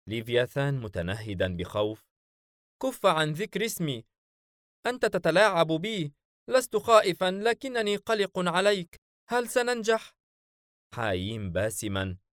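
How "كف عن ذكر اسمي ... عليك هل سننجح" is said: high, anxious companion voice pleading with his master; fretful creature, much higher than the narrator; a nervous spirit begging for reassurance, voiced high; worried jinn sidekick.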